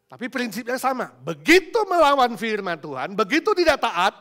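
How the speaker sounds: high, forceful declaration; full-force preaching. Real, not acted. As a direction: drive the principle home to the congregation.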